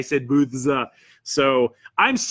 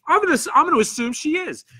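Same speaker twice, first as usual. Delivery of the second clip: bright, much higher, quick playful aside; amused high voice; 12 semitones higher, faster than usual. Acted. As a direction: toss it off high and amused, fast. Reading a chat comment aloud.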